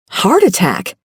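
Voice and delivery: startled gasping echo; gasped. A narrator's reading. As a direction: gasp out "Heart attack?" in alarm. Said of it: "I gasped".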